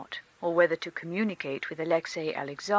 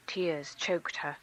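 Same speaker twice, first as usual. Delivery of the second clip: plain reading voice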